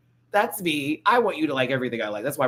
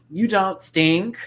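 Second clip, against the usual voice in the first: slow, louder reassurance; slow comforting self-talk; reassuring; 6 semitones higher, 6 dB louder, much slower than usual. Real, not acted. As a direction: tell yourself kindly, slowly. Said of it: "that's my".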